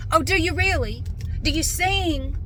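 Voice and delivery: mock an over-curious small-talker; nosy caller. Siding guy prying into customer's YouTube work, high mock voice.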